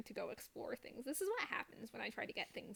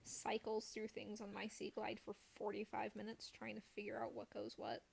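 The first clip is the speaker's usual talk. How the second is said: whisper; 6 dB quieter than usual. Pretend. Self-mocking narration of own fumbling.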